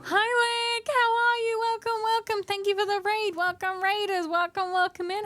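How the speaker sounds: bright high welcome to raiders; high excited greeting. Real, not acted.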